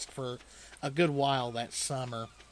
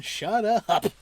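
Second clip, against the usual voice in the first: higher, louder mock shout; retold yell; 5 semitones higher, 7 dB louder than usual. Family yelling back at the kids, higher, louder mock shout. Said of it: "they started screaming".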